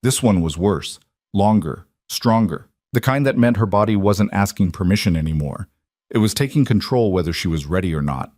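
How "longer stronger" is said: plain reading voice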